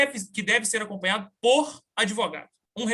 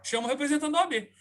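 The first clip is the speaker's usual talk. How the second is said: defiant demand; 5 semitones higher, faster than usual. Retold or imitated. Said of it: "Falei assim com ele".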